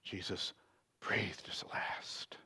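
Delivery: slow, very soft near-whisper; hushed. A narrator's reading. Reader tells of Jesus' death, hushed and slow. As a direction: let it fade away low and slow.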